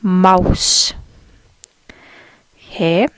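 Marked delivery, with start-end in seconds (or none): 0.0-0.9 mouse with a longer, stressed ow sound; German-style mouse. Shown as a demonstration. Teacher contrasting English and German for learners, stressed ow. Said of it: "There's more emphasis on the ow sound".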